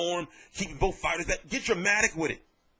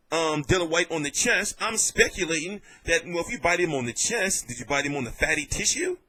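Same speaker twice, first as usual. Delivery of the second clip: normal talking voice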